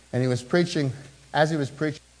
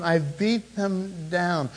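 slow emphasis; 3 semitones higher, much slower than usual. Shown as a demonstration. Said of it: "And in the meantime".

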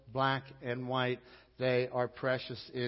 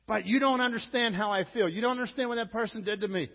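aggrieved objector; 9 semitones higher, 9 dB louder than usual. Acted. Objector protesting, quoted by preacher.

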